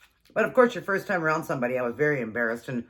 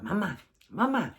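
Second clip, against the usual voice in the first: put-on higher childlike call for attention; a little boy tugging for mom; child calling mom; 5 semitones higher than usual. Mom voicing son calling for her.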